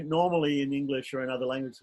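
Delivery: ordinary talk, nothing marked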